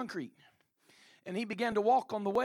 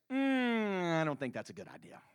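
mock hesitant grumble; reluctant objection; 3 semitones lower than usual.

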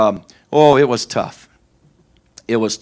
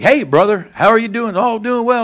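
bright chipper phone greeting, pitched up; 6 semitones higher than usual. Retold or imitated. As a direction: put on a cheery, higher voice. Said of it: "I just sound chipper".

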